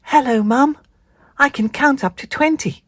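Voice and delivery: high, proud little spider voice. Acted. Spinderella proudly telling Mum she can count. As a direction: tell mum proudly like a small child.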